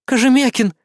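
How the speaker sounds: gasped out, high and urgent; breathless gasp. Acted. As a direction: blurt it out breathlessly. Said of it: "наконец выдохнула она".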